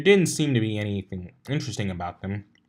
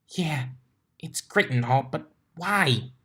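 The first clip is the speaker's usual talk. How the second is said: Twilight's voice; 6 semitones higher than usual. A narrator's reading.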